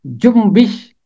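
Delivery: जुम्बिश said carefully, न sounding as म before ब; pronunciation of जुम्बिश. Shown as a demonstration. Teacher to class, showing how जुम्बिश is pronounced, careful and clear. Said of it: "इसका तलफ़ुज़ है".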